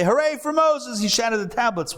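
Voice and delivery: high, ironic hooray; mock cheer. Teacher mocking the sad ending for the class, with a high, ironic cheer. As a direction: cheer it as if it were absurd. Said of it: "And we say".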